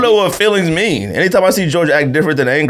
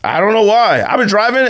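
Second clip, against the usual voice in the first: ordinary talk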